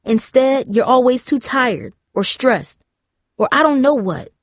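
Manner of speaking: frustrated. A narrator's reading. Darcy confronting her parents about drifting apart, frustrated. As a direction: pour out a pent-up complaint. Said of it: "frustrated at her own words".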